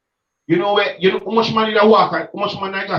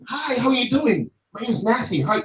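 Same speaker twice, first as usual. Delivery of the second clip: fake polite church greeting; 7 semitones higher, 4 dB quieter than usual. Mocking the polite greeting churchgoers expect from him, higher and sugary. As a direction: put on a sweet, proper church greeter. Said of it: "this is what they would want from me".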